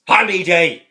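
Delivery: mock grumpy shout; old priest bark. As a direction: blurt it out loud. Jack's one-word reply in retelling.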